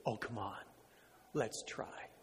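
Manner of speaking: very soft, low, gentle coaxing; twinkle in the eye. Acted. Betsy gently urges her sister to try, soft and low. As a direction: coax quietly, almost under your breath. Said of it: "with a twinkle in her eye".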